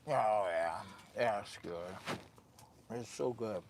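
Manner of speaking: normal talking voice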